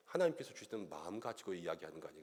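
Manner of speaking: ordinary talk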